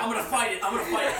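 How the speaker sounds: high, loud, laughing bravado; laughing mock defiance. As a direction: hype yourself up to fight. Joking about fighting car.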